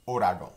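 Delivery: Ouragan said loud and clear, odɑɡo; Ouragan, loudly, for learners to repeat; model pronunciation. Shown as a demonstration. Teacher modelling Ouragan for students, loud. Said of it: "Try that".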